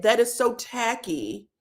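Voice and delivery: ordinary talk, nothing marked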